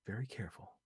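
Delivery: softly, a near whisper; hushed. A narrator's reading. Lucy hushing back to Vi, softly. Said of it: "Lucy whispered".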